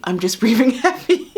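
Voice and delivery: chuckling mid-sentence; laughing. Real, not acted. Laughing at herself.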